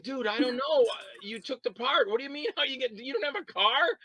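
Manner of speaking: high and loud, incredulous at the actor; exasperated retort. Retold or imitated. Director yelling at an actor with no car, exasperated. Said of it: "I go".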